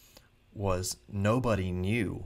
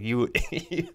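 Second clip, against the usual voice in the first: laughing; 5 semitones higher, 6 dB louder than usual. Real, not acted. Laughing at the unbelievable story.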